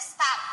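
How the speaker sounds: "stop" ending with the lips closed on the P and no air released; unreleased final P. Shown as a demonstration. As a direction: close the lips on the P in "stop" but never open them. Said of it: "they just leave their mouth closed".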